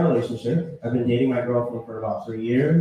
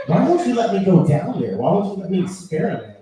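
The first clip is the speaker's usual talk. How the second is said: higher, pushy imitation of a girlfriend; aggressive pleading; 6 semitones higher, 4 dB louder than usual. Girlfriend pressing reluctant boyfriend, mildly aggressive pleading. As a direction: press him with indignant questions. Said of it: "she was a little aggressive with it".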